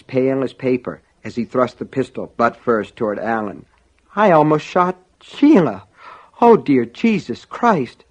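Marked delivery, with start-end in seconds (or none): 4.2-8.0 high, loud, distraught voice; loud, shaken dismay, pitched up; horrified shock. Acted. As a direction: cry out, appalled at the near miss. Officer horrified after nearly shooting her.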